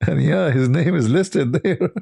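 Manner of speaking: can barely get it out for laughing; laughing while talking. Real, not acted. Telling viewers the debater's name is listed, laughing.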